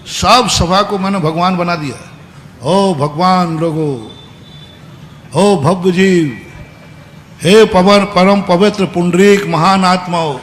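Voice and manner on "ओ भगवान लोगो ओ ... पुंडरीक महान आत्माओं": slow, syrupy mock-sweet praise; overly sweet address. Preacher mocking his own sweet talk to the assembly, slow and syrupy. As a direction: pour on exaggerated sweetness, drawn out. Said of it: "खूब मीठा बोला मैं".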